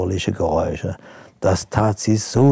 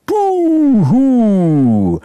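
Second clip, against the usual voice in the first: long, much higher and louder spooky Buhu; ghostly boo; 13 semitones higher, 7 dB louder than usual. Acted. Princess under a tablecloth scares the ghost, long loud spooky boo. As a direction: howl Buhu like a playful ghost. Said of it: "breitete die Arme aus und rief".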